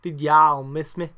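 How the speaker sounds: loud, slow Southern drawl; imitated Southern drawl, called out loudly; put-on Southern accent. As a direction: stretch "y'all" open like a mock Southerner. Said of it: "that sounds a bit better".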